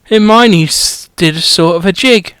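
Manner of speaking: usual speaking voice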